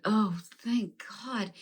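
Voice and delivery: slow, soft relieved sigh of words. Retold or imitated. Told there is no bad karma, slow soft relief. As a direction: let the relief out slowly. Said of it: "And I said".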